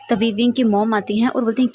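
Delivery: plain reading voice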